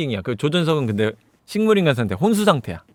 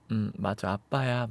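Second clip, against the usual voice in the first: very soft, low reply; weakened, hushed acted voice; tender dying father; 7 semitones lower, 14 dB quieter, slower than usual. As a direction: murmur gently to your daughter. Dying father answers daughter tenderly.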